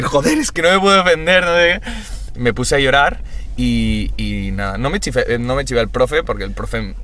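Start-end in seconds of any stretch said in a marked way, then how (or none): 0.0-2.4 tearful complaint. Retold or imitated. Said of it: "de la impotencia de decir".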